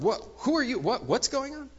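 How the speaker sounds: high, sudden startled questions; startled man. Acted. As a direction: jolt awake and blurt in alarm. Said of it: "he's startled".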